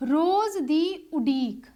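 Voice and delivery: ਰੋਜ਼ ਦੀ ਉਡੀਕ said slowly, word by word; deliberate teacher read-out of ਰੋਜ਼ ਦੀ ਉਡੀਕ; slow read-out for counting. Shown as a demonstration. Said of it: "ਪੰਜ ਜਿਹੜੇ syllable".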